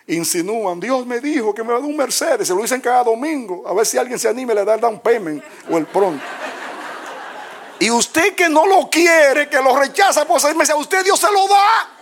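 fiery preacher rising to a roar; preaching at full force. Acted. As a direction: thunder it from the pulpit, climbing. Preaching climax, rising to shouts.

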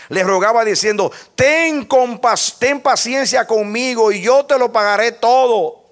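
a preacher retelling the parable to his congregation in full voice; preaching. Acted. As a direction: preach it with rising fervor.

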